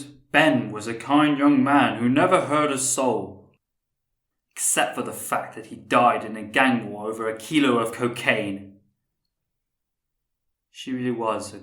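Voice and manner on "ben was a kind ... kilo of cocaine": loud, slowed mock tribute; mock eulogy. Neighbour parodying the tributes people give the dead, loud mock eulogy. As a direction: proclaim it like a hollow public tribute.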